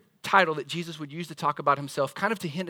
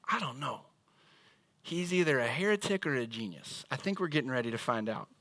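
aside; 5 semitones lower, 6 dB quieter than usual. Retold or imitated. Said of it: "other disciples being like".

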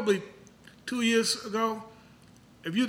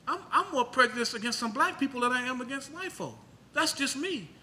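ordinary talk

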